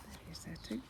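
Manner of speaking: hushed whisper aside; soft whispered aside. Acted. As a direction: whisper it to the children. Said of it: "aside whisper".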